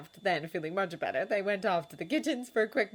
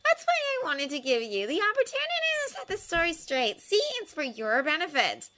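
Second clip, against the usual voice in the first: sly journalist fishing for a reaction; Rita Skeeter; 12 semitones higher, 4 dB louder than usual. A narrator's reading. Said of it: "smiling and staring beadily at Harry".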